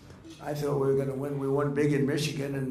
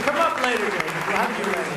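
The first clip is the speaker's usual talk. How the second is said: much higher, louder, altered quoted call; retold call to David; 6 semitones higher, 7 dB louder, faster than usual. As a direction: act out telling David to wait. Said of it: "I said".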